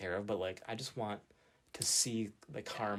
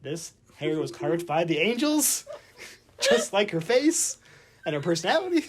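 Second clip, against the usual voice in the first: gushing fan; 6 semitones higher, 11 dB louder than usual. Retold or imitated. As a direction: swoon over her like a smitten fan. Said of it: "and I'm like".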